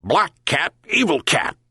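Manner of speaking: high yelping dog; dog yelping at the cat; yelped. A narrator's reading. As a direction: yelp it out like a little dog. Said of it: "yelped the dog".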